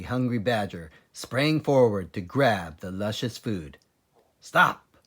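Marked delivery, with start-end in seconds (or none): 4.4-5.0 loud, high command; sharp order. A narrator's reading. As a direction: stop them dead with one shout. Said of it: "ordered Mister Fox".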